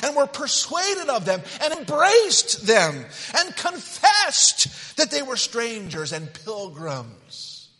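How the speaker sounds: high, loud, slow declamation; proclaimed scripture. Acted. Preacher declaiming Hebrews to the congregation, loud and high. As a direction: lift the verse up with full force.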